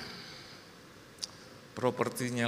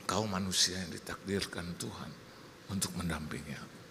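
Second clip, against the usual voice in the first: low, measured mock-solemn husband; solemn address; 6 semitones lower, 4 dB quieter than usual. Husband addressing his destined companion, low and solemn. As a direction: pronounce it gravely, voice sinking low. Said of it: "Saya cuma bilang".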